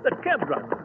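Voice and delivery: usual speaking voice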